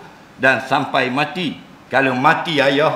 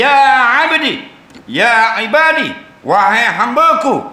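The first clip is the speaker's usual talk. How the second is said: tender divine call; 10 semitones higher, 6 dB louder than usual. Acted. Preacher voices God calling believers on judgement day, tender and exalted. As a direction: call out to them like a fond master summoning his own. God lovingly calls his servants.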